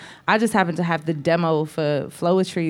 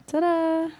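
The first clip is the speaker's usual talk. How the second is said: high, drawn-out ta-da; playful flourish; 11 semitones higher than usual. Acted. Singer capping her studio story, playful high flourish. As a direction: pop it out as a reveal.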